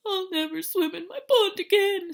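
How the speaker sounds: high, loud tearful wail; sniffling. Acted. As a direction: cry it out like a sad frog. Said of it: "sniffed Frog".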